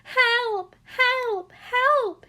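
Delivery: high, loud drowning cries; yelling for help. A narrator's reading. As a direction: yell desperately to be rescued. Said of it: "he yelled".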